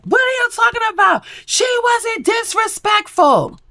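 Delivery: high, shrill-pitched mock yell; imitated shout. Kata yelling back at the guys, imitated outrage.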